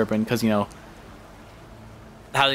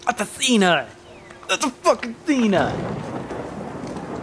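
awed; 7 semitones higher, slower than usual. Real, not acted. Awed by Athena scene.

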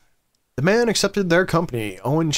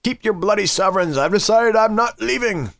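raised, higher voice of an angry woman; acted indignant yell, building louder; angry screaming; 6 semitones higher, 3 dB louder than usual. Angry woman yells at strangers from her window, screaming. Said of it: "began to scream at them".

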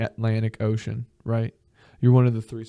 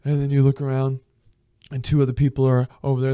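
normal talking voice

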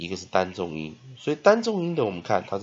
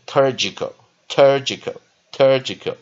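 "turgical" with a heavy, louder TUR; stressed first syllable; 4 semitones higher, 8 dB louder, slower than usual. Shown as a demonstration. Teacher drilling the stressed syllable for learners, "turgical" with stress on TUR. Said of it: "我们拉重音念".

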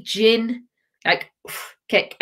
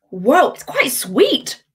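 1 surprised whoa; 5 semitones higher, much slower than usual. Real, not acted.

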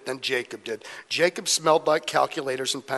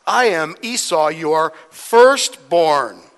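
loud, drawn-out announcement; grand acted claim of identity; emphatic declaration; 7 dB louder, much slower than usual. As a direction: proclaim it slowly, leaning on each word. Jacob declares false identity to father.

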